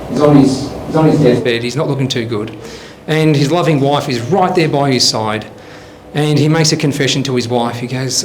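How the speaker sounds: normal talking voice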